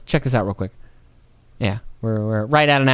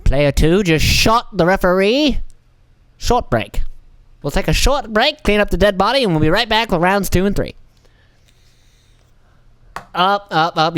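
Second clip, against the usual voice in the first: sports announcer; 4 semitones higher than usual. Acted. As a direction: announce the break like a TV commentator.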